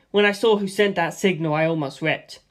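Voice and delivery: plain reading voice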